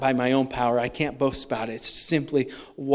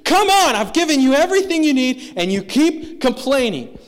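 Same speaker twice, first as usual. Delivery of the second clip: much higher, loud, fed-up complaint; exasperated God; 9 semitones higher, 6 dB louder than usual. Retold or imitated. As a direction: play God at the end of his patience, slowing at the end. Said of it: "Could you imagine God's attitude?".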